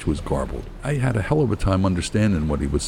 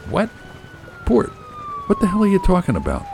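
high, quick, incredulous retort; baffled outburst; faster than usual. A narrator's reading. Husband baffled by Kelly's order to look to port, high-pitched confusion. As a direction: snap back in confusion, pitched right up.

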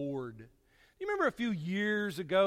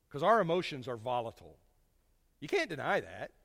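ordinary talk

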